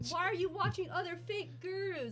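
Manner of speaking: imitating Matt. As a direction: parody the know-it-all trader. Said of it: "She's quoting December, Matt".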